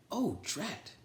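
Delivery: high, fretful little toad voice; a small grumpy frog-ish sigh of annoyance; Toad's dismay. Acted. Toad finding he lost a button, high fretful voice. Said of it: "said Toad".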